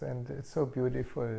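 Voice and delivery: ordinary talk, nothing marked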